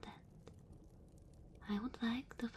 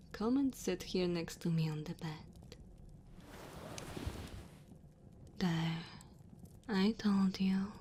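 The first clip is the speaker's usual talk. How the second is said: tender dragon woman; 3 dB louder than usual. Acted. Dragon woman warming a human by her fire, tender. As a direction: comfort the cold guest, gentle and unhurried.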